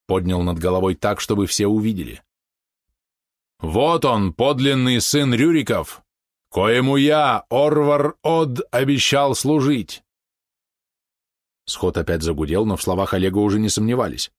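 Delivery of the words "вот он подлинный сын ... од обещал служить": slow, solemn and raised, higher than usual; solemn proclamation. Acted. Oleg presents Rurik's son to the assembly, slow and solemn. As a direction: hold the child up and declare it slowly to the crowd.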